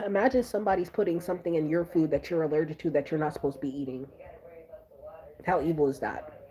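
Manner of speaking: ordinary delivery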